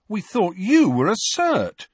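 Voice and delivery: as if he'd been tricked; accusing. A narrator's reading. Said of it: "a tone that suggested Harry had tricked them".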